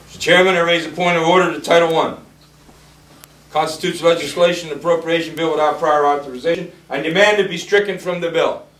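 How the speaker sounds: loud, high, insistent demand; re-enacted floor objection. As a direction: object loudly before the whole chamber. Re-enacts own objection in Congress.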